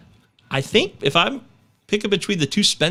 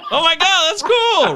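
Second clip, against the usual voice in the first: delighted squeal; 14 semitones higher, 4 dB louder than usual. Real, not acted. Delighted by accent trick.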